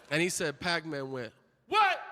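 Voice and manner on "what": high, loud, abrupt What; imitated aggressive shout; sharp retort. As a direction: fire it back as a challenge. Player snaps back at the fan.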